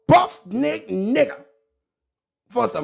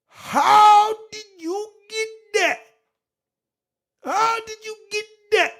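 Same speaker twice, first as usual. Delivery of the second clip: Cornelius; 12 semitones higher, 4 dB louder than usual. Acted. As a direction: stretch it out in a high, incredulous cry. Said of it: "And Mike Cornelius".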